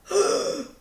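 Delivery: sharp, flattered gasp; a high little gasp of delight. Real, not acted. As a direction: gasp as if touched by the praise. Flattered by a comment.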